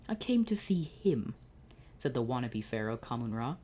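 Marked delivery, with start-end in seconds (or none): 0.1-1.3 higher, slow, lightly lisped villain; lisping villain. Acted. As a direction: sneer it with a lisp. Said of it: "recognized the lisped voice".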